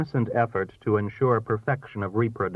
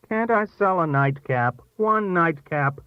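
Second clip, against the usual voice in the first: high, coaxing plea; wheedling host, pitched up; insistent pleading; 8 semitones higher, 3 dB louder than usual. A narrator's reading. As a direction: keep begging the guests to stay. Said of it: "Thorne kept saying".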